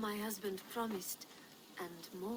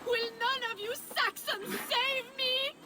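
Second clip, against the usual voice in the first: mock scream for help; 13 semitones higher than usual. Princess staging her own abduction for the guards, hammed-up cry for help. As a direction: fake a kidnapped princess screaming for rescue. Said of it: "Your screaming drew half the guards".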